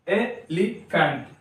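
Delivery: Elephant slowly, e, li, phant; three-beat teaching elephant; syllable by syllable. Shown as a demonstration. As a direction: split elephant into three with short gaps. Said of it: "तीन syllable हो गया".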